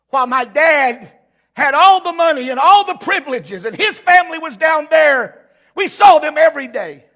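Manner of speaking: heated pulpit storytelling; impassioned retelling. Retold or imitated. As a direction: retell the grievance with rising force. Voices the man's bitter account.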